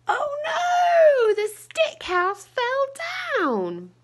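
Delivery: high, loud shocked oh no; storyteller shocked for the children; shocked gasp. A narrator's reading. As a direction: gasp and cry out as the house falls. Stick house collapses.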